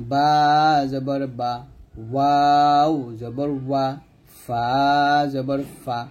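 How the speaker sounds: বা, ওয়াও, ফা each held long before its short syllable; model recitation. Shown as a demonstration. Teacher recapping lip letters for students, বা, ওয়াও, ফা elongated. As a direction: spell out slowly, stretching বা, ওয়াও and ফা. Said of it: "এরকম".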